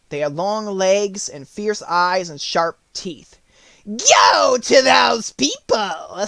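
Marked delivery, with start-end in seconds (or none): 3.9-6.3 high, slow, wicked witch voice. Acted. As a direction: sneer it like a cruel witch. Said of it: "said the witch".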